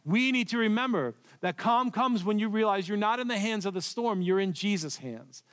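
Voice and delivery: ordinary delivery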